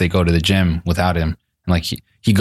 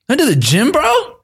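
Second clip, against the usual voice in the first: much higher, incredulous squeaky voice with laughter; freaking out. Retold or imitated. Vlogger learning his friends went to the gym without him, squeaky and freaking out. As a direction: act wounded disbelief at being left out. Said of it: "freaking out".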